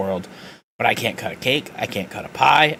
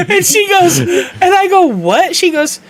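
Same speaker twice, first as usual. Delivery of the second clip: high loud laughing voice; can barely get it out laughing; 7 dB louder than usual. Real, not acted. Laughing hard before Brenda's punchline.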